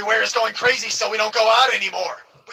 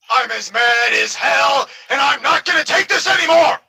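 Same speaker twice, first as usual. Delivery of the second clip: yell; 5 semitones higher, 4 dB louder than usual. Retold or imitated. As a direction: shout it to the whole street. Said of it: "stick your head out and yell".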